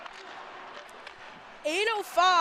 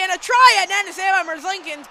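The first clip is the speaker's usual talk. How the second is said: louder burst of excitement on the shot; excited play-by-play; 2 semitones higher, 8 dB louder, faster than usual. Real, not acted. Announcer calling a shot on goal, excited. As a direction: lift the volume as the shot comes.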